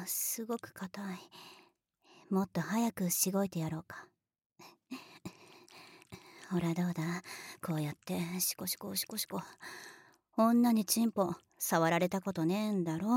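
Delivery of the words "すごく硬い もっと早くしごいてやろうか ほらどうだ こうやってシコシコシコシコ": soft unvoiced teasing whisper close to the ear. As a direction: breathe the words, teasing. (からかうようなささやき)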